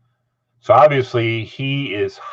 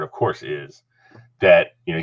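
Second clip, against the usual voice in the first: normal talking voice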